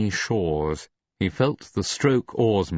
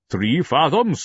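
calling out; 8 semitones higher, 4 dB louder than usual. A narrator's reading. Leadsman calling soundings.

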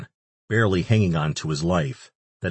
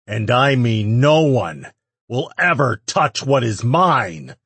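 proclamation; 5 semitones higher, 5 dB louder than usual. A narrator's reading. Addressing all of Hell.

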